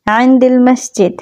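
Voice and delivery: slow, clear আইনদিল মসজিদ; teacher modelling আইনদিল মসজিদ word by word; careful pronunciation. Shown as a demonstration. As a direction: pronounce আইনদিল মসজিদ slowly for learners. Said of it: "উচ্চারণটা দুটো দিকে একটু খেয়াল করবেন".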